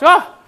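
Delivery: mock-shocked "ah", high and loud; shocked gasp. Preacher acts out Jesus hearing Peter's answer, shocked gasp. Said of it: "like, shocked".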